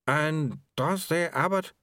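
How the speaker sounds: slow, wavering hesitant ask; lightly voiced nervous official; hesitant question. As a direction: probe nervously.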